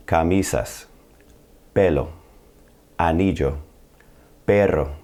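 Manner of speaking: "Camisas, pelo, anillo, perro" as ca-MI-sas, PE-lo, a-NI-llo, PE-rro; next-to-last syllable stress. Shown as a demonstration. Teacher models first category, Camisas to perro, second-last syllable stressed. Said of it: "the next to last syllable".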